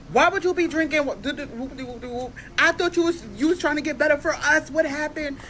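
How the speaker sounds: high, exasperated mock whine; exasperated scolding. Girlfriend scolding boyfriend for drinking, exasperated. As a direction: scold him in disbelief.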